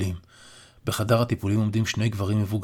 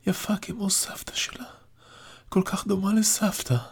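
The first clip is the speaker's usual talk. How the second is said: put-on hushed, slow, higher old man's whisper; 11 semitones higher than usual. A narrator's reading. Dying grandfather whispers to his granddaughter, slow. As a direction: whisper it through tears.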